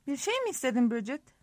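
plain reading voice

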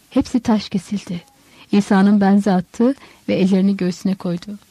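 ordinary delivery, nothing marked

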